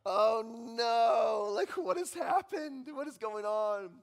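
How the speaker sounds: a dad who suddenly sees the disaster; alarmed dad. Retold or imitated. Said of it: "And I'm just like".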